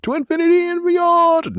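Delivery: high, loud heroic toy-ad catchphrase; Buzz Lightyear. Retold or imitated. Quoting the Buzz Lightyear ad in a high heroic boom. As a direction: belt it like the spaceman in the ad. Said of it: "remember that Buzz Lightyear commercial?".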